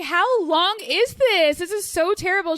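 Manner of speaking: hammed-up anguish over the wait; desperate to know how long Saturn return lasts; mock despair. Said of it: "I was like".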